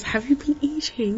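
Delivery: mum's voice. Retold or imitated. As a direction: lightly voice mum asking her son. Mum voiced in a story.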